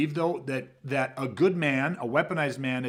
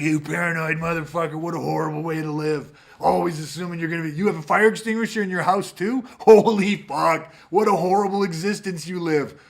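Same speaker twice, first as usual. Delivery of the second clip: mocking scoff; 2 semitones higher, 6 dB louder than usual. Mocking a seatbelt wearer, loud and sneering. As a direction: scoff at him like a smug critic. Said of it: "ran up and was like".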